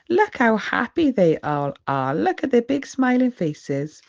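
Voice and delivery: ordinary delivery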